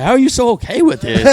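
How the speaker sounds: mock-incredulous date, high; imitating baffled date. Quoting his bewildered date.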